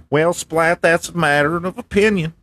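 lightly voiced MAGA guy; MAGA man character. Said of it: "Listen, MAGA man".

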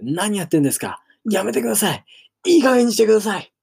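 high, loud frantic protests building up; shouting until the voice gives out, rising; exasperated yelling. Acted. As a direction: yell at them to stop, fed up. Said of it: "と叫び続けた".

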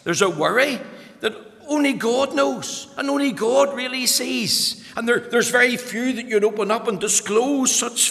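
high, ringing preacher's voice; impassioned preaching. Acted. Pastor speaking to his grieving congregation, impassioned. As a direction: reach out to the hurting in the pews.

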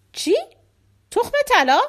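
high, loud astonished question; very surprised. Acted. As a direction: exclaim it, unable to believe it. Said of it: "که خیلی تعجب کرده بود گفت".

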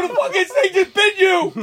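high-pitched retold alarm; acted-out shocked exclamation; freaking out. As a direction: point out the disaster in comic panic. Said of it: "I'm freaking out".

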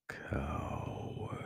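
hoarse whispered sneer; griped in a whisper. A narrator's reading. Vagabar sneering at the fleeing warriors, whispered. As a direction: spit it out under the breath. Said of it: "Vagabar griped".

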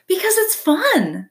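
high, slow, delighted; a singsong answer from a born performer; playful opossum. Acted. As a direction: squeal it with glee. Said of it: "said the opossum".

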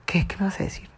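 hushed curious reply; whisper. Retold or imitated. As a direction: neighbor whispering back, curious. Neighbor voiced whispering back.

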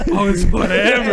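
loud laughing retell; laughing through it. Retold or imitated. Retelling his threat to the guy, laughing. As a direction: laugh while mocking the line. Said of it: "So I go".

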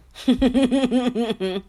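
high, loud rippling laugh; real giggle fit. Real, not acted. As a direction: break into a long run of giggles. Laughs after her trigger warning.

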